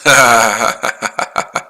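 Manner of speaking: sudden chuckling burst; laugh. Real, not acted. Trader reading viewer comments, bursts out laughing. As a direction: break into a run of laughter.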